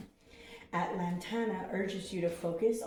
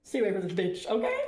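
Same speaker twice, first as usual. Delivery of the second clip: laughing warning; 8 dB louder, faster than usual. Real, not acted. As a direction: warn them off through a laugh. Laughing reaction to card's monster.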